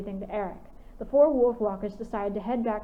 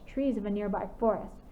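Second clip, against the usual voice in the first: ordinary delivery, nothing marked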